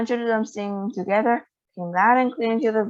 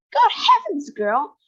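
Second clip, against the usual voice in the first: high shrieking alarm, much higher; 7 semitones higher, 3 dB louder, slower than usual. A narrator's reading. Mother shrieks at her daughter Violet, high and shrill. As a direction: shriek at the girl in sudden alarm. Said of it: "shrieked Missus Beauregard suddenly".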